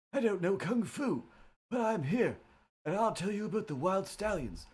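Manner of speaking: a spaced-out stoner talking. Pretend. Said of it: "sounding like a stoner".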